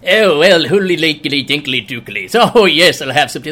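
hammed-up posh British accent with gibberish; stodgy British mock. Mocking stuffy Brits with a fake British accent. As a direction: play a fussy Briton in a mock British accent. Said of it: "you guys are too stodgy".

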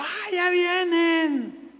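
high, slow squealing ¡Ay, ya vienen! with a laugh; high-pitched mocking fangirl squeal; giddy fan squeal. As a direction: mimic an overexcited fan spotting celebrities. Imitating excited fans arriving.

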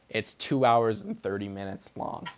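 usual speaking voice

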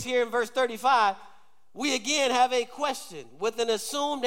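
high, loud-edged sermon drive; preaching. Real, not acted. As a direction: preach to the crowd with punched, insistent phrases. Preaches at full force.

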